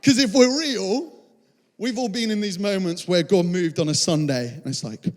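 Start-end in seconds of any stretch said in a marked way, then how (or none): none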